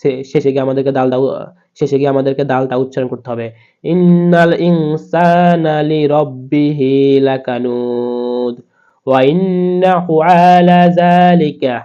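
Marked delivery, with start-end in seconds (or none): 3.8-11.8 slow, raised Quranic chant; slow recitation. Shown as a demonstration. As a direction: lift the verse and draw every vowel out. Reciting the verse.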